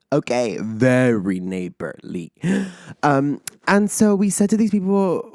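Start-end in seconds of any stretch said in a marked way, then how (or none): 0.1-2.3 sarcastic. Retold or imitated. Sarcastic repeat of the praise.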